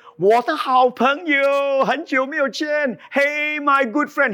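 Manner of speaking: butter him up like a stranger after something; fawning stranger. Retold or imitated. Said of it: "a stranger comes up to you and say".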